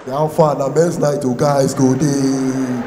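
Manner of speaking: loud, low, drawn-out call; deep hammed-up man's invitation; mock male hailing. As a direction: holler it slowly to the guys. Mock invite to men's night.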